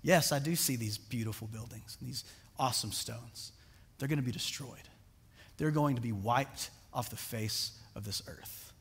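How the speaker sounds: dry, low, dismissive retort; sarcastic Jesus. Retold or imitated. Preacher voicing Jesus sarcastically to disciple, dry and low. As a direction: quietly brush off the admiring disciple. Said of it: "Jesus says".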